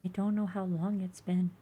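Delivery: put-on high, soft, faint baby creature; small high creature voice. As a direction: plead quietly like a fragile hatchling. Egg creature voice.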